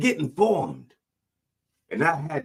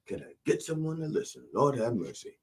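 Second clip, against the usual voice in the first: ordinary talk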